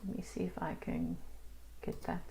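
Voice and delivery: near-whispered aside, very soft; under the breath. Real, not acted. Teacher to herself while working the screen, under the breath. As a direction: murmur it to yourself.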